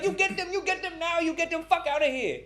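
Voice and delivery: high, loud squawked orders; frantic attendant impression. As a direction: imitate a worked-up attendant demanding they be thrown out. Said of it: "She's like".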